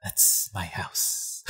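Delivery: very slow, breathy whisper; a sleepy whisper from the couch; whispered deadpan. Retold or imitated. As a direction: breathe it out lazily, slowly. Tsuchi lounging, deadpan reply.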